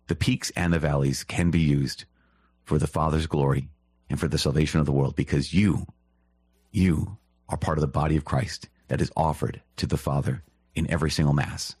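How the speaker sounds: normal talking voice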